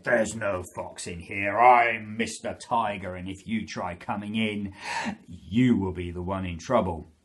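roaring storybook tiger threat; Mister Tiger. Acted. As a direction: roar it out to scare off a visitor. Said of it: "He roared and he said".

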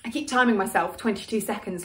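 usual speaking voice

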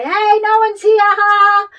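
very high, very loud drawn-out yell; gleeful holler. Pretend. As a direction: shout freely through an empty house. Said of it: "can just say".

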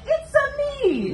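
high, slow cartoon plumber cry; a hammed-up high Italian cartoon cry; Mario voice. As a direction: squeak it out like a video game plumber. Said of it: "like someone murdering a Mario brother".

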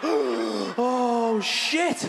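drawn-out, rising cry of dismay; horrified realisation. Retold or imitated. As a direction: gasp it out in acted horror. Horrified reaction after the kick.

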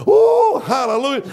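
high, loud ecstatic cry; joyful shout. Real, not acted. Preacher getting shouting happy, ecstatic cry. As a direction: let out a burst of praise.

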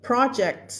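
"project" stressed on the first syllable; stress on PRO. Shown as a demonstration. Teacher showing the noun, "project" stressed on PRO. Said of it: "อักเสียง pro".

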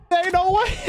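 high, amazed "no way"; you can't believe what just happened; stunned disbelief. Real, not acted. Disbelief right after the fight.

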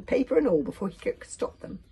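plain reading voice

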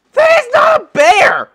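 shocked shout. Real, not acted. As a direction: scream it in real shock. Shocked by a huge mob.

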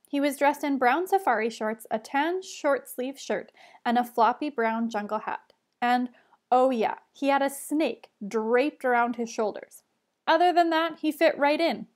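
plain reading voice

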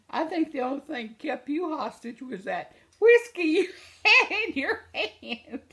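high, laughing mock tease; teasing quote, laughing. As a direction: tease him through laughter. Guy teasing about whiskey, laughing.

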